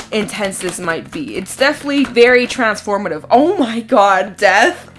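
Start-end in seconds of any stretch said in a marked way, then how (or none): 3.3-5.0 shocked. Real, not acted. Death card pulled.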